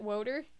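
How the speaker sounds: "Woter" with a long "oh" vowel; low, questioning "Woter", said "woh-ter"; altered "Woter". Shown as a demonstration. Player checking how to say it, "Woter" with long "oh". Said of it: "Is that how you say it".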